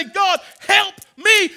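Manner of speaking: slow, high shouted plea; lifted voice. Shown as a demonstration. Preacher urging the church to lift their voice, slow shouted plea. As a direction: lift your voice and cry it out. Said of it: "You can lift your voice and say".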